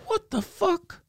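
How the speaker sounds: high, soft squawky parrot voice; imitating a parrot; parrot mimic. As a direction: squeak it like a bird. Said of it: "You would go".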